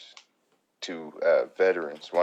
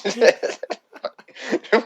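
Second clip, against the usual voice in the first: high-pitched burst of laughter; 8 dB louder than usual. Real, not acted. Friends trading stories, high loud laugh. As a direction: cackle at your own story.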